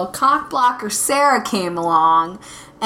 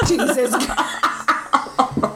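slow, breathless laughing Jesus Christ; laughing through words; 3 semitones higher, much slower than usual. Real, not acted. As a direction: gasp it out mid-laugh. Reacts to the Top Gun answer.

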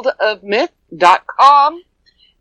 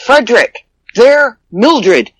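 normal talking voice

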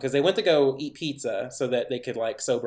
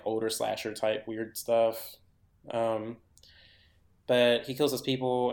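normal talking voice

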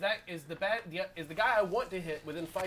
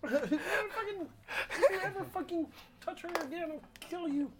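Kermit voice. Acted. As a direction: menace them as Kermit, pitched way up. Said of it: "Kermit's voice".

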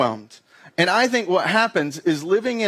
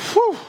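relieved exhale; 13 semitones higher, 3 dB louder than usual. Retold or imitated. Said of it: "you're like".

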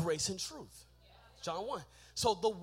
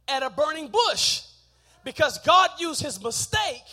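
loud, raised sermon delivery; full-force preaching; 8 semitones higher, 14 dB louder than usual. Acted. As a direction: drive each point home to the congregation.

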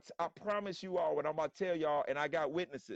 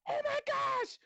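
high, shrill-pitched mock panic from the mom and daughter; imitating the mom and daughter freaking out; slower than usual. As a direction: squeal it like horse people losing it. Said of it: "They're like".

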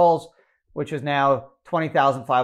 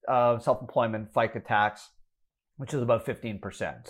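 normal talking voice

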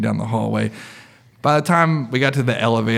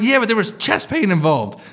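high-pitched, pleading self-justification; defensive protest; 9 semitones higher than usual. Retold or imitated. Patient justifying himself to the nurse, defensive protest. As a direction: insist on it, playing up the indignation.

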